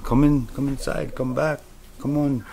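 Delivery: mock them luring him back in; taunting call. Partygoers call the dreamer back inside, lightly voiced high beckoning.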